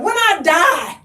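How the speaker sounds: hammed-up defiant reply; defiant retort. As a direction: snap back at the kids playfully. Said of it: "my boys are like".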